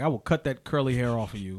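low, soft mock threat; retold threat. Man with a knife threatens to cut hair, low threat. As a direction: imitate an understated menace, dropped in pitch.

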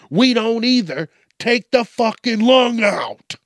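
high, loud threatening order; bully her into giving the lung up; loud mock repo man threat; menacing demand.

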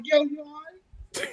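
slow, drawn-out quiet call; hushed, stretched concern; mock dazed friend check. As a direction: lean in slowly to a knocked-out guy. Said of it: "So it's like".

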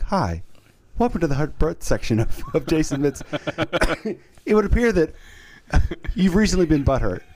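lightly voiced airline safety announcer; mock polite safety briefing; airline safety video voice. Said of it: "one of those airline, safety brief videos".

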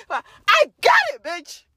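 high, slow, loud crow; crowing win; triumphant shout. Retold or imitated. Retelling her win to doubters, loud crow.

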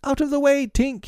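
put-on mild shout in a child's high voice; shouted warning. A narrator's reading. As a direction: call out high to clear the way. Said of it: "he shouted".

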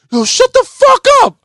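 very high, loud mock outburst; playful yelling. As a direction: shout it in play, hammed up. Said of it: "But I'm playing".

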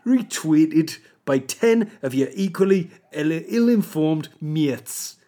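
emphatic put-on Geordie accent. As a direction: rant like a Sunderland fan, in broad Geordie. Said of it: "do this in a Geordie accent".

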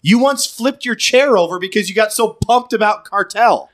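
high-pitched excited objection; animated, incredulous rebuttal; heated protest. Real, not acted. Animated friendly argument, catching him out.

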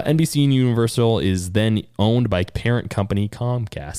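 ordinary talk, nothing marked

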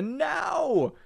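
high squealing no; mock outraged shriek. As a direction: shriek it in fake horror. Mock outrage at pineapple pizza.

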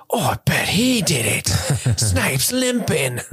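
slow, hammed-up Australian-sounding kid; botched British sliding into Australian; accidental Australian accent. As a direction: drawl it like a bad Australian accent. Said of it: "Why is he Australian?".

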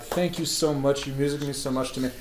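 retold soft, awkward thanks; awkward gratitude. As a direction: murmur thanks during a too-long hug. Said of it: "I can feel it's getting awkward".

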